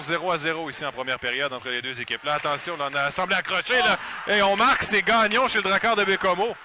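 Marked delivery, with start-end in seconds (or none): 2.2-6.5 rising excitement to the goal; excited goal call. Acted. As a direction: build it up as the puck goes in. (appel de but excité)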